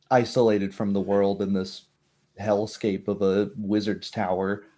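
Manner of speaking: normal talking voice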